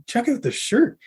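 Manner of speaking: mock Dean showing the shirt to the band; high, eager mock announcement. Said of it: "and I".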